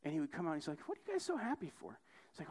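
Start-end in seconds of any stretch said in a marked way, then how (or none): 0.9-2.0 high, quick, soft question; mock wonder, pitched way up and hurried; puzzled question. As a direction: imitate a baffled visitor in a high voice. Acted curious rich visitor.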